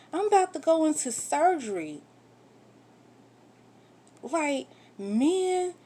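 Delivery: high, loud, outraged rant; angry outburst. Real, not acted. Wife ranting at husband who refused to hold her hand, outraged and loud. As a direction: let the anger at him rip out.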